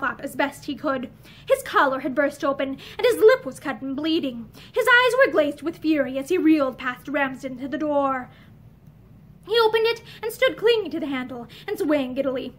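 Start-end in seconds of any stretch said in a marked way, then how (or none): none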